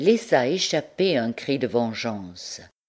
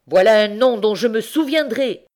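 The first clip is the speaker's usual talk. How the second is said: loud, higher threat; vindictive cry; 7 semitones higher, 7 dB louder than usual. A narrator's reading. Old uncle slamming the door, vindictive cry. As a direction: spit out a vow of revenge. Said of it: "s'écria le vieillard vindicatif".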